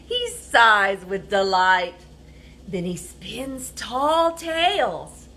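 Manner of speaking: lively picture-book reading; animated read-aloud storyteller; reading to children. A narrator's reading.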